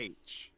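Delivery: H said as "haitch" with an H sound; "H" pronounced haitch, British way. Shown as a demonstration. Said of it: "We say H".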